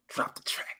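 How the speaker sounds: hushed aside to the DJ; whispered. Retold or imitated. Fans quietly cueing Lacey's music, in a whisper. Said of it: "they're gonna be like".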